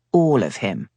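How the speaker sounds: ordinary delivery, nothing marked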